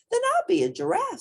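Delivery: high, fast and eager; excited little hippo; eager decision. A narrator's reading. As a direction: announce the new plan happily. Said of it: "said Portly".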